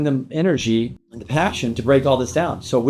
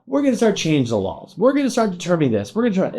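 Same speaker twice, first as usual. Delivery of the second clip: higher, mocking official announcing new rules; mock government official; 11 semitones higher than usual. Mocking the government changing real estate laws, higher mock official voice. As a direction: lampoon the officials in a raised voice. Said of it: "government coming in here saying".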